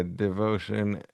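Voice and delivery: ordinary talk, nothing marked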